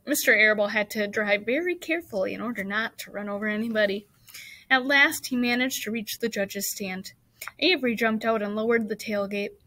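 plain reading voice

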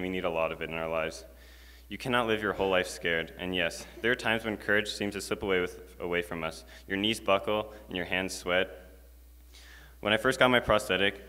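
ordinary talk, nothing marked

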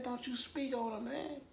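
squeaky high mocking imitation of Big Act; mock quote. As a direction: parody the threat in a tiny high voice. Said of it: "He said".

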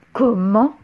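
how dare you; outraged What. A narrator's reading. Witch outraged question.

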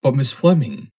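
high, loud cackling protest; cackled. Acted. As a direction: cackle it out in a panic. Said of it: "cackled Foster".

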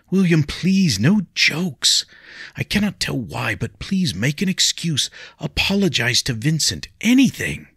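put-on pleading young lady. Lady begs William not to fight, high and pleading.

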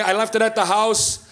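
usual speaking voice

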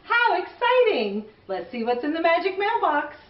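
usual speaking voice

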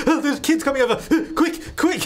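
high, laughing mock panic; panicked dad. Voicing the dad frantically vacuuming for guests. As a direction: squeal it like a flustered dad rushing. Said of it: "He's like".

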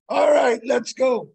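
high, loud imitation of an angry challenger; mock challenge yell. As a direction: yell it like a man squaring up for a fight. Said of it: "guy starts yelling".

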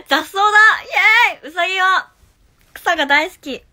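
high, loud, fast laughing cheer; giddy outburst. Real, not acted. Laughing at her own slip with a loud giddy cheer. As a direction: burst out giggling and cheering.

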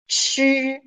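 ordinary talk, nothing marked

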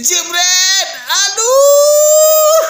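high cry of disbelief; excited shout. Real, not acted. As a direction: yell as it goes wide. Shot just misses.

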